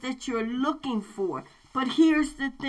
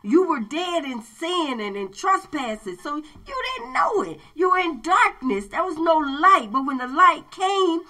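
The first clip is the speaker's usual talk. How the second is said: loud, high fervent preaching; 4 semitones higher, 4 dB louder than usual. Acted. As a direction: preach it out with rising fire. Preacher builds to full force.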